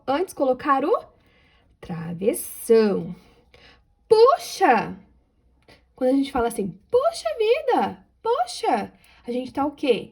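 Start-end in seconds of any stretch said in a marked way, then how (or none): 4.1-4.9 high, loud exclamation of Poxa; exclaiming. Shown as a demonstration. 6.9-8.9 much higher, slow "Poxa vida!" exclamation; exclaiming. Shown as a demonstration.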